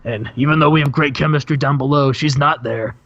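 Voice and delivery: a hint of a gravel-voiced wrestling star; Randy Savage voice. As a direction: channel the Macho Man cutting a promo. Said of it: "cuts him off and says".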